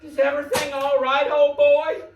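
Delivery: put-on high, loud fussing at the big old boy; hammed-up worry for the sidekick; mock concern. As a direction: coax him like a hurt pup. Fussing over the upset assistant.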